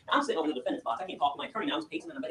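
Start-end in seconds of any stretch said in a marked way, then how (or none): none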